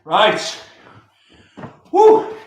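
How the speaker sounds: loud, high victory yell; triumphant shout. Real, not acted. Handstand t-shirt challenge just succeeded.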